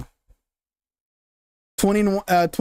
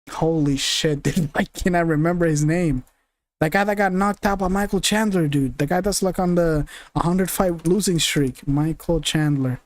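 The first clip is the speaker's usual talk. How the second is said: ordinary delivery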